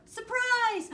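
very high, loud party yell; surprise shout. Acted. Friends greet Froggy at the door, excited shout.

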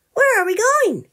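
put-on high, loud child question; curious little bear. Tommy asking Grandpa where they go, high and curious. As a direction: ask like an eager cub.

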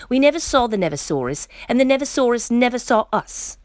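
high, fast storybook complaint; protesting, high and quick; complaining. A narrator's reading. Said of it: "complained Tiny".

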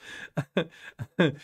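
real self-mocking chuckle; helpless little laugh at oneself. Real, not acted. Host laughing at their own missed chance to promote, real chuckle. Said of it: "I'm laughing 'cause".

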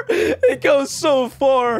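slow, laughed through the words; laughing. Real, not acted. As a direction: say it while cracking up. Laughing at deep hole.

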